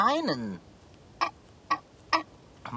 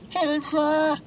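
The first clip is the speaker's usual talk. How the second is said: calling for help; 7 semitones higher, much slower than usual. Acted. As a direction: yell it out so anyone hears. Cat stuck in pipe calls.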